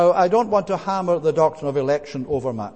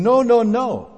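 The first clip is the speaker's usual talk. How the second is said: higher, louder no, no, no; emphatic denial; 7 semitones higher, 5 dB louder than usual. Acted. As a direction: cut the idea off firmly from the pulpit. Preacher rejects the complacent view.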